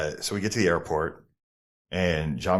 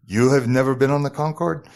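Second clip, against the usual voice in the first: put-on raised, careful French-accented English question; halting French-speaker English; 6 semitones higher, 5 dB louder than usual. French driver questions his passenger, imitated French-speaker English. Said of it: "this dude barely speaks English".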